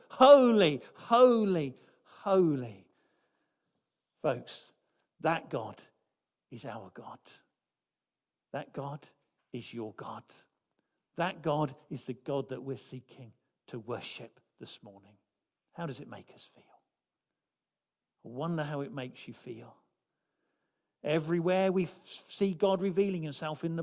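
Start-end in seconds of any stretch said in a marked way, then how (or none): 0.1-2.9 louder, ringing out, falling away on the last; proclaimed holy. Shown as a demonstration. Preacher proclaiming the angels' triple holy, ringing loud. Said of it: "Three times for emphasis". 4.2-19.7 hushed appeal. Acted. Preacher drawing the congregation close, softly and low. Intimate appeal after the climax.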